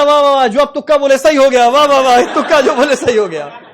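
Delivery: high, loud mocking wah wah wah; sarcastic cheering. Trainee sarcastically cheers the lucky guess, loud and high. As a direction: cheer him on mockingly, half-laughing. Sarcastic praise after the lab test.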